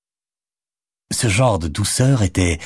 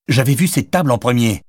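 indignant; 6 semitones higher than usual. A narrator's reading. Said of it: "s'indigna-t-il".

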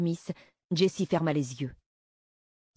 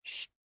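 soft drawn "shh"; acted warning shush; 15 dB quieter than usual. A narrator's reading. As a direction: hush a child discreetly. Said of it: "elle lui fit signe de se taire".

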